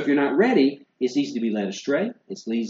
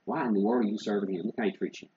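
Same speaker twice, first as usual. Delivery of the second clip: softer, lower voice of a tempter, lightly acted; whispering tempter; 4 semitones lower, 7 dB quieter, faster than usual. Satan planting doubt in a believer's ear, hushed mock whisper. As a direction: slip the doubt quietly into their ear. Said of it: "Satan's gonna be whispering in your ear".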